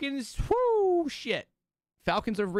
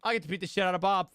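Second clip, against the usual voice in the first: ordinary delivery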